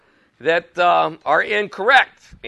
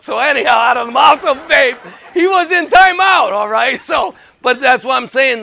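much higher and louder, delighted; excited shout; 7 semitones higher, 8 dB louder than usual. Real, not acted. Grandfather telling the meeting his grandson's joke, excited shout. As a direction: belt out the punchline with joy.

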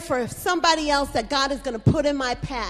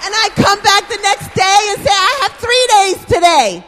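shouting over the cheering crowd; excited announcement, shouted high; 10 semitones higher, 10 dB louder than usual. Real, not acted. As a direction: belt it out, thrilled.

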